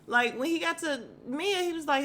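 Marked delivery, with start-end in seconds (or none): none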